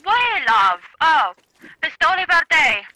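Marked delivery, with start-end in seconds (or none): 1.8-2.8 Italian attempt. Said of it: "I'm trying to learn Italian".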